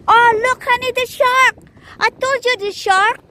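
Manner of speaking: high, loud excited call. Real, not acted. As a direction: point it out with a thrill. Spotting a shark, excited.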